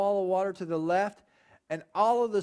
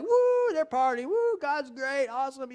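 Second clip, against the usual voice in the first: whooping celebration; 5 semitones higher than usual. Retold or imitated. The crowd cheers after the Egyptians drown, whooping.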